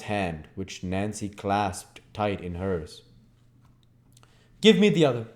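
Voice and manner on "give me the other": louder, higher gruff command; Sikes's rough order. Acted. Sikes orders Oliver's other hand, gruff. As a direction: order it curtly. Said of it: "said Sikes".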